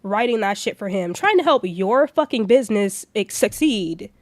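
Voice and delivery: loud, heated telling-off; ranting loudly at the manager who ripped up the script; heated retort. Retold or imitated. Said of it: "I said".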